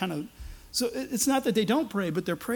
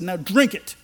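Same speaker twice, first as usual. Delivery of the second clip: louder, a retold angry order; angry command; 7 dB louder than usual. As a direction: bark it like a furious prophet. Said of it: "He, he got so mad".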